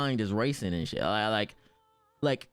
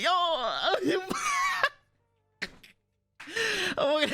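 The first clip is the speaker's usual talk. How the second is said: high crying laughter through the words; laughing mock wail. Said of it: "I'm like".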